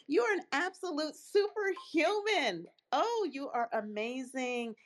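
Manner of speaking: high excited praise; gushing. Real, not acted. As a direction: gush with delight.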